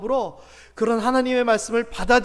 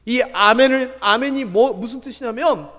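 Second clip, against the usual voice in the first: ordinary talk, nothing marked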